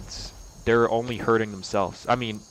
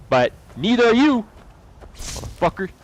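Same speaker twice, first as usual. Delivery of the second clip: much higher, louder jab; angry; 10 semitones higher, 5 dB louder than usual. Real, not acted. Calling the victim out, angry shout. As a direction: fling it at her, loud and sharp.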